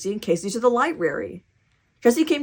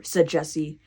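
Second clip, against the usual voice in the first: plain reading voice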